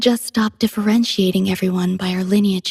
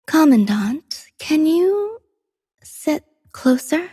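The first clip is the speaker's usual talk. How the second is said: tentative request; 6 semitones higher, slower than usual. Acted. Shyly asks Commandant to come closer.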